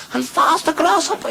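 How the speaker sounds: very high, urgent command; frantic master. Acted. Master urging Grasshopper on, frantic high cry. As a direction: squeal it at fever pitch. Master urges pupil, climax.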